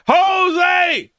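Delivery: high, loud, drawn-out yell; playful scolding shout; shouted name. Real, not acted. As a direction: holler the name in mock outrage. Calls out the joking viewer.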